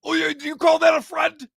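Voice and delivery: high, loud comic protest; mock indignation. Host goading co-host about his friend, mock indignant. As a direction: protest loudly on his behalf.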